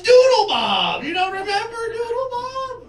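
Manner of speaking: high, loud, outraged fan; indignant girl. Retold or imitated. Girl scolding him for forgetting Doodle Bob, high and loud. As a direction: insist on it, shocked he forgot. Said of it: "And she goes".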